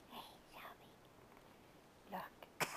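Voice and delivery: hushed call; whisper. Real, not acted. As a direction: whisper her name to catch her eye. Whispering to get baby's attention.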